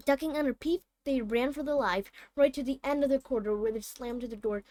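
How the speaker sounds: plain reading voice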